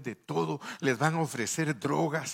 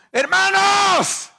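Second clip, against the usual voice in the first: screamed, much higher and louder; desperate shout; 16 dB louder than usual. Acted. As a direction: cry out to absent brothers for help. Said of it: "No te asustes porque voy a gritar".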